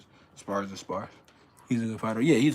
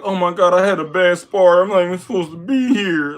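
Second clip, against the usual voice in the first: mock despair; 7 semitones higher, 9 dB louder than usual. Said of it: "Don't feel like".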